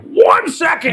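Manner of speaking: very high-pitched mock yell; irritated coworker shout. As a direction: imitate an annoyed holler from the back. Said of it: "a question that pisses him off".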